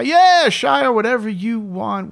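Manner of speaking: high, loud start easing down; over-the-top sarcastic yes; mock agreement. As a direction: humor them with exaggerated willingness. Retold mock agreement to star.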